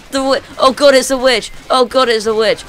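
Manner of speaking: loud, high-pitched cries for help; frantic gamer panic, voice shot up; panicked shouting. Real, not acted. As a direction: yell in alarm at a sudden monster. Player panics on meeting a witch.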